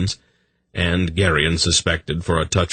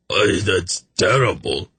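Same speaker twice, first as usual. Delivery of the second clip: mild gasp of disgust; a touch of shuddering revulsion; 2 semitones higher than usual. A narrator's reading. Sick man after gulping the remedy, mild gasp. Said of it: "he gasped".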